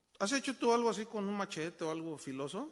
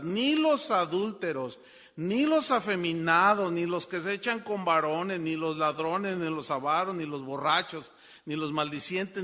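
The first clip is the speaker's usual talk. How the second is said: loud preaching litany, easing gradually; thundering Bible list, tapering off; forceful scripture reading; 8 dB louder than usual. Acted. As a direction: hammer out each item, slow at first then quicker. Reads scripture list at full force.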